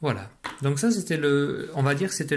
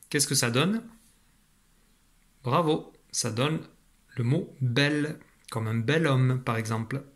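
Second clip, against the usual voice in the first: ordinary talk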